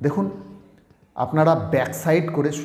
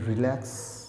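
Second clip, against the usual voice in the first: demonstrated relax; 5 dB quieter than usual. Shown as a demonstration. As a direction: draw "relax" out until it fades. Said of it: "কথাটা উচ্চারণ করবেন".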